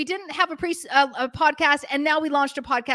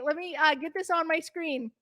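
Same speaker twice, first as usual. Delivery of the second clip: ordinary talk, nothing marked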